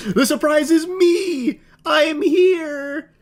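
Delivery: high, slow, sing-song creep voice; mock creepy reveal. Mocking the stalker's big reveal, high and drawn out. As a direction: stretch it out high like a proud stalker.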